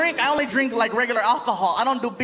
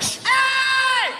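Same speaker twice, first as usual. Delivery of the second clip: very high, drawn-out holler; mock drunken high-pitched cry; drunk mirror guy; 14 semitones higher than usual. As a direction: call out like a happy drunk.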